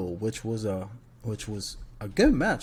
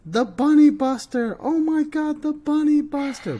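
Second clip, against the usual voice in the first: high, loud, frantic wrestling commentator; over-the-top ringside announcer shout; Michael Cole impression; 6 dB louder than usual. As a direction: call the big move in an excited high-pitched yell. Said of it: "Michael Cole, bro".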